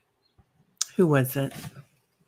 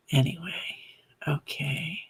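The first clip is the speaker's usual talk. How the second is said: whispering; 2 semitones higher, 6 dB quieter than usual. Real, not acted. Hushed transition before the reading.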